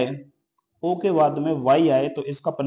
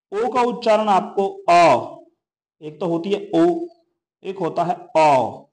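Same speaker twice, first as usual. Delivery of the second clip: normal talking voice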